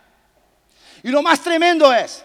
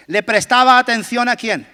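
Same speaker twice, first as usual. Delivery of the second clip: ordinary talk, nothing marked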